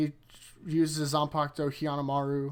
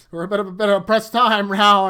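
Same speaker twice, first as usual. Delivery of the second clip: mock urgent Renji; 5 semitones higher, 13 dB louder than usual. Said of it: "And he's like".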